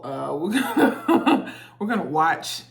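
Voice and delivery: words broken up by laughter, slowed; laughing. Real, not acted. Telling viewers what they'll watch, laughing through it. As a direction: giggle your way through the announcement. Laughing while announcing the reaction.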